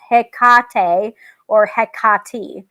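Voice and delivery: Hecate said slowly, stress on the middle syllable, heh-KAH-tay; Hecate drawn out with the middle syllable KAH stressed; ancient pronunciation. Shown as a demonstration. Teaching viewers the ancient way to say the goddess's name, slow. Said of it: "pronounced with emphasis on the middle syllable like this".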